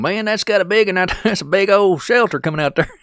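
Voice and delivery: high, loud hammed-up Kentucky hillbilly voice, laughing through it; hillbilly local accent. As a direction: shout it like an excited Kentucky local. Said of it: "That's exactly how some of the natives would be".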